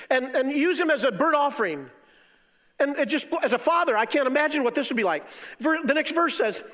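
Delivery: high, loud, pained pulpit voice; a loud, high, anguished sermon; impassioned preaching. Acted. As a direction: preach it like a father imagining the loss. Preaching at full force.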